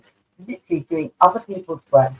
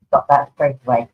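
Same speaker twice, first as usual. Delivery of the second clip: ordinary delivery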